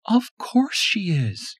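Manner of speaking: wife calming injured husband; reassuring Tanya. A narrator's reading. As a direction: reassure him slowly.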